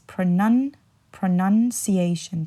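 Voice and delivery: pronunciation slowly, stopping after NUN and starting over; pro-NUN, then pronunciation drawn out; noun form demo. Shown as a demonstration. Teacher shows the noun form, pronunciation slowly with NUN. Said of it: "But as a noun".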